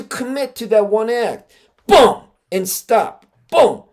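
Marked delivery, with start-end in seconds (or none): 1.9-2.2 loud, high, sudden boom; explosive single-word impact; punched strike sound. Retold or imitated. Imitates the committed sword strike. 3.5-3.8 loud, high, sudden boom; explosive single-word impact; punched strike sound. Retold or imitated. Imitates the committed sword strike.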